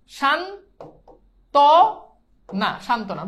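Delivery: সান্ত্বনা drawn out as "shan... to... na", then said whole; long gaps between "shan", "to" and "na" in সান্ত্বনা; teacher slowly sounding out সান্ত্বনা with o on the t; syllable-by-syllable demo. Shown as a demonstration. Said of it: "এটা কি হবে?".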